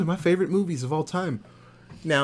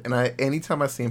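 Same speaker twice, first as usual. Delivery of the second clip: ordinary talk, nothing marked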